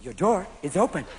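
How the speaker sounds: lightly voiced dashboard reminder; talking car. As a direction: announce it like a car's warning. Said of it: "Japanese cars where you open the door".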